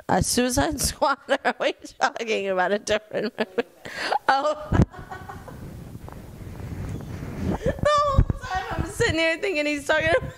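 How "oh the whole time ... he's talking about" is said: high, squeaky words through laughter; helpless laughing. Real, not acted. Adult realizing own movie misunderstanding, talking through laughter. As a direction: squeeze the words out while cracking up.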